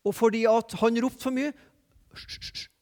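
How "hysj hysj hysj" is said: sharp voiceless shushing; hushing. Acted. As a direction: shush someone repeatedly under your breath. Acting out the crowd hushing him. (hyssjende, uten stemme)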